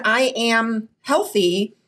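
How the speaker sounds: I am healthy, said slowly and firmly; slow, deliberate affirmation; slow declaration. Retold or imitated. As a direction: stretch out I am healthy like a vow. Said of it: "when I said".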